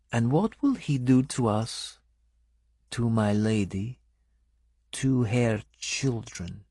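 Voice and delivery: put-on softly accented woman, unhurried; lightly voiced foreign woman. A narrator's reading. Benedetta telling the narrator about the king, lightly accented.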